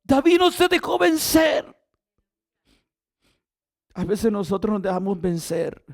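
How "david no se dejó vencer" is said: loud, high, fervent sermon delivery; full-force preaching. Acted. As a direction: preach it to the whole church, full voice. Preaching climax.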